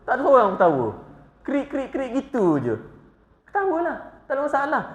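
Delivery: high, driving preacher's voice; fervent preaching. Acted. Preacher urging the congregation to weep freely, fervent. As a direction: preach it out to the hall.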